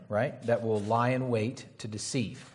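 slowed, deliberate repeat of the phrase; slow emphasis. Shown as a demonstration. Preacher drilling a warning into the congregation, slow and deliberate. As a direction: lean on "lie in wait to deceive", slow and deliberate. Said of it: "repeats the phrase slowly for emphasis".

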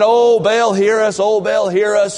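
hammed-up pleading chant; mock crying out. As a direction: cry out like desperate priests. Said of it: "they're crying".

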